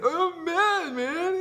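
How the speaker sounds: very high, squealed fake-cry protest; mock tearful anger. Insisting he's mad not sad, hammed-up tearful squeal. Acts out crying while mad.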